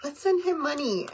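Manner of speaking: excited mom. Retold or imitated. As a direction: play the mom who wants to donate. Mom voiced in the story.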